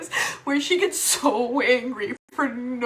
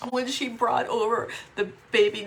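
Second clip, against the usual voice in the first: ordinary talk, nothing marked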